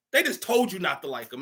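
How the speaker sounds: ordinary talk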